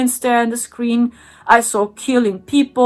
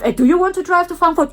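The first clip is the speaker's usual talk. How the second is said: mock hologram voice; 7 semitones higher, much faster than usual. Holograms talk about Frankfurt.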